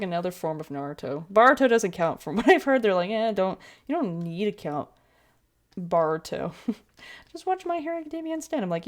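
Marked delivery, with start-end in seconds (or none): 3.1-8.6 airy, laughing mock advice; dismissive fan voice. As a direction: brush it off like a know-it-all fan. Mocking fans' dismissive advice.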